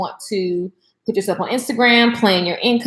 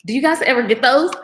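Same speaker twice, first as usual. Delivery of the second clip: ordinary talk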